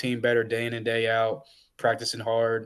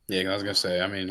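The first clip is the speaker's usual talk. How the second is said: ordinary delivery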